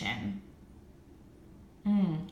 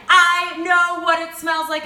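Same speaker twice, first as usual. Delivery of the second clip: higher, louder and slower, leaning on every word; emphatic excited repeat; 9 semitones higher, 10 dB louder than usual. Real, not acted. Repeating her discovery to viewers, louder and slower. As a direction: say it again, bigger, savoring the discovery. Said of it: "Oh my God, it just hit me".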